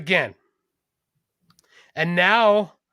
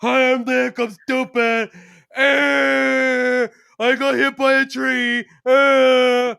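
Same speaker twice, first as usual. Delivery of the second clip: loud, high, dim-witted mock voice; braying caricature with held-out grunts; dopey self-parody; 6 semitones higher, 8 dB louder, much slower than usual. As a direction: play yourself as a loud idiot. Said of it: "she'd be like".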